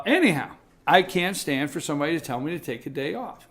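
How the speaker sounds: ordinary talk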